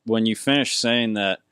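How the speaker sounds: ordinary talk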